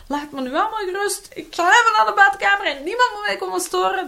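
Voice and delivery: high, loud, fed-up outburst; snapping parent. Shown as a demonstration. Parent snapping that nobody may disturb her, loud and high. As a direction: bark it at the kids to back off. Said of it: "Als jij gaat zeggen van".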